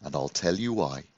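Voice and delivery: soft, confiding promise; softly. Acted. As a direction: draw him in gently. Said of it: "Rabbi Yisrael answered softly".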